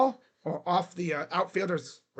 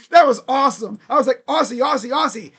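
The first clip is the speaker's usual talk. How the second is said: loud, high, thrilled cheering; elated fan shout; excited celebrating; 5 semitones higher, 9 dB louder than usual. Real, not acted. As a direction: celebrate at full voice. Celebrating the base hit.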